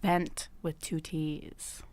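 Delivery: whispering. Pretend. Whispered aside in sign-off.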